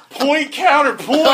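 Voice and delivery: high, slow, laughing words. Real, not acted. Host summing up a quote while cracking up. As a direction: squeeze it out between laughs.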